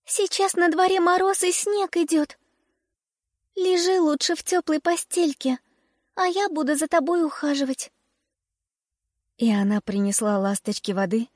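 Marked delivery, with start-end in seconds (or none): 0.0-7.9 put-on high, caring little girl; tiny girl fussing over a patient. A narrator's reading. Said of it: "сказала дюймовочка".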